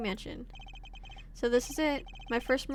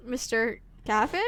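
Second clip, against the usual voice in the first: normal talking voice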